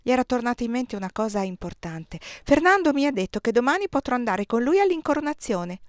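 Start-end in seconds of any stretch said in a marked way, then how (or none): 2.2-5.8 put-on higher child voice, eager and proud; a small boy bursting with news; child eagerly telling mother; little boy Oscar. A narrator's reading.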